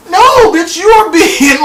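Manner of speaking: high, loud scolding; exasperated retort. Retold or imitated. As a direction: cut in loudly with high-pitched disbelief. Acted retort to the catfish.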